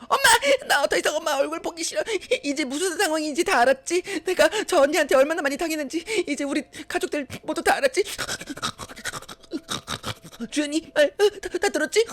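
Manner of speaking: high, sobbing, fast accusation; crying outburst. Acted. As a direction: shout it through tears at mother. Daughter crying out at mother.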